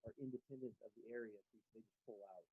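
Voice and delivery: faint and far off, barely audible; muted mic, voice fading away; muffled mic. Real, not acted. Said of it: "[name], we can't hear you".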